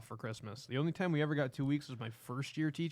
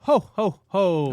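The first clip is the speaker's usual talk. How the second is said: booming ho ho ho; big jolly Santa laugh; 5 semitones higher, 13 dB louder, much slower than usual. Acted.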